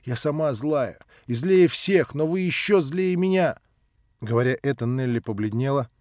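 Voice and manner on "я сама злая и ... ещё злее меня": put-on louder, higher child's angry no; Nelly flings злой at him, loud and high; angry refusal. Nelly refuses the old man, loud angry child. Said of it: "прибавила она".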